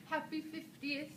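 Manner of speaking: put-on British accent. Recording a greeting for the blog, laughing in a mock British accent. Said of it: "in the British accent".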